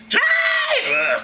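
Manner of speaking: very high, stretched cry; mock shout. Comic interrupting himself about the voice, shrieked name. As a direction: yell the name in a hammed-up squeal.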